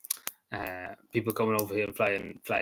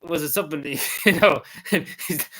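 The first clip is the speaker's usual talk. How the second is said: ordinary delivery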